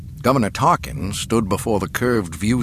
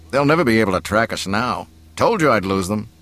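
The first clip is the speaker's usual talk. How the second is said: fast, offhand boasting; cocky pilot; 2 semitones higher, 4 dB louder, faster than usual. Acted. Pilot boasting to his passengers, breezy and cocky. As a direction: brag casually about the escape.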